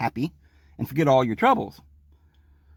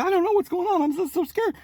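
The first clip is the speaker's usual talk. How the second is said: much higher pleading voice, a scared patient; imitating a scared patient; 13 semitones higher than usual. Patient to the doctor, much higher, mock-scared pleading. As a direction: whimper it out like a panicked patient.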